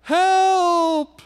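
high, sustained call; crying out for help. A narrator's reading. Missionary cries out a third time, calling for help. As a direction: hold one long high note of a cry. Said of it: "the third time he cried out".

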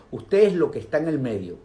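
ordinary delivery

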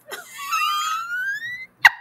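high squealing burst of laughter; shrieking laugh. Real, not acted. Host reacting to a viewer's joke with a shrieking laugh. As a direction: cackle up high, almost a scream.

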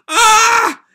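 very high, loud anguished scream; piercing anguished scream. Acted. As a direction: let out a wild scream. Nataku screaming.

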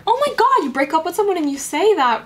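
be scandalised on her behalf; outraged. Real, not acted. Reader outraged at what the boyfriend said.

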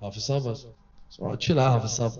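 soft, low inner voice telling a friend to chill; hushed calming aside. Retold or imitated. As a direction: talk him down quietly in your head. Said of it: "I thought".

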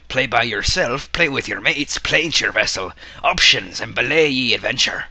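hammed-up pirate, punching each phrase; pirate speak. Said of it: "That's pirate speak".

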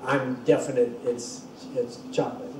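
flat, sure statement, dropping soft at the end; definite. Shown as a demonstration. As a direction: say it like there's no doubt. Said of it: "It's not".

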